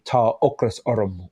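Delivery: Tá ocras orm spoken slowly in Irish; Irish phrase. Shown as a demonstration. Showing a friend how hunger is said in Irish, slow. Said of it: "In Irish, you'd say".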